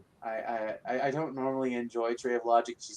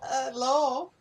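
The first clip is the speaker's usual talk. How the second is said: calling out; 14 semitones higher, 8 dB louder, much slower than usual. Pretend. Echoing the call to him.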